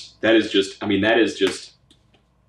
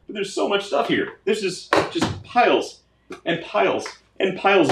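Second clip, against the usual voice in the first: much higher, awed voice; amazed; 10 semitones higher than usual. Real, not acted. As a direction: marvel at the pile. Amazed at the haul.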